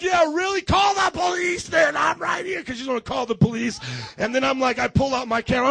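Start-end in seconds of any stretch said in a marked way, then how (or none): 0.0-2.6 high, loud, defiant yelling; retold angry shout. Pastor dares screaming neighbor to call police, defiant shout. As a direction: re-enact daring her at full volume.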